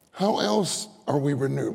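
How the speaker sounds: ordinary talk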